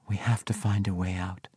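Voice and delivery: hushed, whispered urgency. A narrator's reading. She whispers to him as he enters the room, whispered. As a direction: whisper it under the breath.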